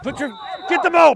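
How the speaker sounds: ordinary talk, nothing marked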